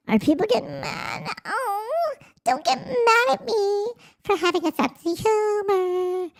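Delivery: high, sing-song pleading; mock whiny. Streamer teasing annoyed viewers in a high whine.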